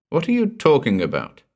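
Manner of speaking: uneasy demand. A narrator's reading. Narrator challenges Gordon's strange words, uneasily demanding. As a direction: demand an answer, unsettled. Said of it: "I demanded uneasily".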